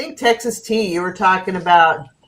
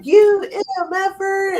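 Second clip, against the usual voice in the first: mock angry yell from a passing car, pitched way up; retold yell; 13 semitones higher than usual. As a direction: imitate a stranger screaming an insult, high. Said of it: "just starts yelling at him".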